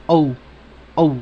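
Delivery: "O" said as a joined O-U glide; joined O-U sound. Shown as a demonstration. Teacher isolates the united vowels, "O" as a gliding demo. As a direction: give "O" alone, gliding into U. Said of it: "se dice unida".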